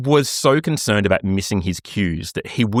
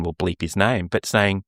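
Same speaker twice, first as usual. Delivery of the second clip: ordinary talk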